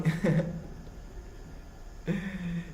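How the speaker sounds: long, high laugh; amused laughter. Real, not acted. Host finding friend very funny, long high laughter. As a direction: keep giggling, really tickled.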